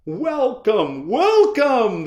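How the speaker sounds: high, loud eager welcome; eager cry. Acted. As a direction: greet him like slick salesmen. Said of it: "they cried".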